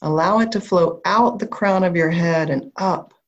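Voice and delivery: calm grounding visualization, unhurried; guided meditation. Acted. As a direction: guide them gently down into the body.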